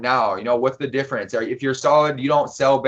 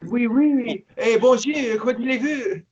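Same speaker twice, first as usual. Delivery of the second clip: higher-pitched, hammed-up French accent; 7 semitones higher than usual. Reading alone in his cell, in a mock French accent. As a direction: ham up a French accent for a laugh. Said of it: "do it in the French accent".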